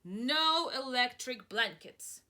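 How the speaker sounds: loud firm refusal; farmer refusing. Acted. Farmer Brown refusing the cows, loud and firm. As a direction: flatly shut them down. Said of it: "said Farmer Brown".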